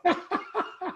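high burst of laughter. Real, not acted. Player laughs at his proud parents, high burst. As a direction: laugh out at the memory.

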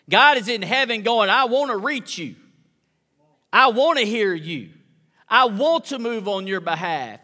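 fiery pulpit preaching; full-force preaching. Acted. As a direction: preach it hard to the room. Preacher raising voice to congregation.

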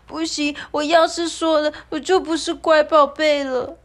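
put-on high, loud, anxious child's voice; 害怕的小孩声音; scared child. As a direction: afraid to break the secret. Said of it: "团团害怕的摇摇头说".

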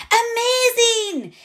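high, slow delighted squeal. A narrator's reading. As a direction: squeal with joy, drawn out. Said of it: "Elena squeals and claps her hands".

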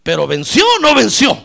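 loud challenge to the congregation; punchy, shouted preacher's question; rousing shout. Real, not acted. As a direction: call it out forcefully from the pulpit.

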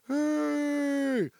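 put-on very high, loud drunken yell; drunk shout. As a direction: bellow it across the room while pointing. Drunk boss yelling from stage.

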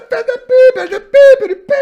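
laughing squeal. Real, not acted. Teasing laugh about the girls.

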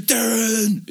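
high yelled name; mock scream. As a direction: scream the name like a wounded buddy. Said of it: "he's like".

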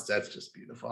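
hushed, moved whisper; soft whisper of mock awe. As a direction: breathe it out as if overcome. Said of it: "It's like, I'm".

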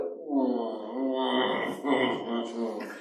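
soft savoring mm over food; savoring hum. Retold or imitated. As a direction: hum like tasting mousse. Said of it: "I was just like".

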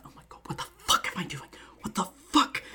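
frantic whispered swearing; whisper-yell, building on the repeat; muted freak-out. Acted. Merlin mutes himself during the fall, whispering in panic. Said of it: "he just mutes himself and he's like".

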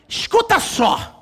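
scare the kid with a big fake threat; hammed-up threat. Father warning his daughter the police will take her, hammed-up loud threat.

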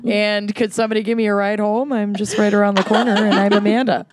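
hammy Minnesotan movie quote; Minnesotan accent. Retold or imitated. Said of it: "unleash their worst slash best Minnesotan accents".

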